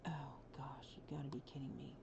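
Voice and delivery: exasperated whisper; frustrated whisper. Real, not acted. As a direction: whisper it in disbelief. Game glitch, frustrated whisper.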